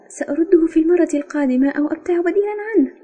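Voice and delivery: high, quick, put-out retort; resentful. A narrator's reading. Member offers to replace the pencil, resentfully. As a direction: answer fast and high, annoyed. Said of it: "قلت في استياء".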